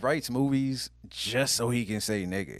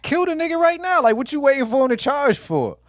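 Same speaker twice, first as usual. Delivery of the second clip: high, loud, impatient rant; exasperated viewer; 13 semitones higher, 7 dB louder than usual. Retold or imitated. Host mimics viewer fed up with charging scenes, loud impatience. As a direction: urge it on in pitched-up exasperation.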